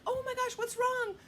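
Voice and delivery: high, urgent worried voice; alarmed mom. Retold or imitated. Mom running to her supposedly hurt son, alarmed. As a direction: rush in high with acted alarm.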